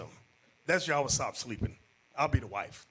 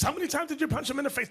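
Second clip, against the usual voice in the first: fast and high, disbelieving; rapid incredulous question; 11 semitones higher, much faster than usual. Retold or imitated. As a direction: rattle off the question. Bishop interrogating the sister.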